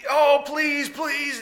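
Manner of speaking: high, shouted mock plea; begging Haman. Haman pleads before Esther, high mock sobbing. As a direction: beg for your life. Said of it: "blubbering and blubbering and, oh, crying".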